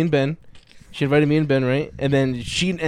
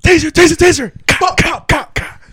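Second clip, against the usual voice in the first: high frantic yell; shouting; 14 semitones higher, 12 dB louder, slower than usual. Retold or imitated. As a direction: yell it like a panicking cop. Reenacting cop video yells.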